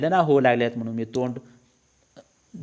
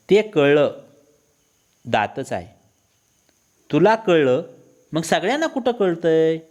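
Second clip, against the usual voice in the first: louder, higher scolding comeback; exasperated wife, voice raised; wife's retort; 5 semitones higher, 3 dB louder than usual. Acted. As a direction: put the irritated husband in his place. Wife retorts in acted quarrel.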